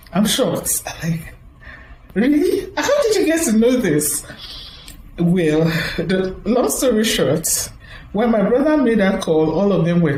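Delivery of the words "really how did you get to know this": higher, a touch of laughing surprise; surprised really. Retold or imitated. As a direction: ask in mild disbelief. Her surprise retold.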